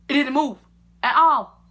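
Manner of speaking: high, loud complaint; outraged. Retold or imitated. Telling viewers about hair, high loud outrage. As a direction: shout it in disbelief.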